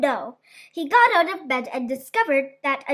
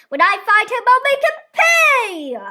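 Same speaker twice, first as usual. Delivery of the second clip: loud, higher furious giant threat; 7 semitones higher, 6 dB louder than usual. A narrator's reading. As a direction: spit out the vengeance loudly.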